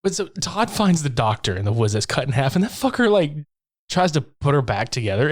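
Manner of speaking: ordinary talk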